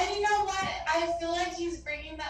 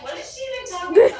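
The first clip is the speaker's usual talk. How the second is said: quoting the uncle through a loud laugh; laughing quote; 5 semitones higher, 12 dB louder, faster than usual. Retold or imitated. Medium voicing the uncle, loud and laughing. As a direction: laugh out his words, amused. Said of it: "He's saying to me, like".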